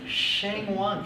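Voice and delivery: "Shang Wan" with "Shang" as a back-of-the-mouth sh; Chinese name pronunciation. Shown as a demonstration. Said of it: "the she has to come from the back of the mouth".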